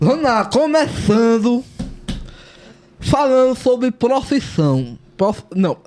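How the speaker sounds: talking with tongue sticking out; slow words with the tongue out. Real, not acted. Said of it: "Ficar um minuto falando com a língua pra fora".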